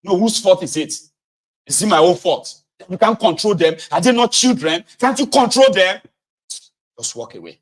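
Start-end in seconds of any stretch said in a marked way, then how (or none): 0.0-6.0 loud, high accusing shout; shouting back at his wife about the kids; angry retort. Retold or imitated. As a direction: throw the blame back at her.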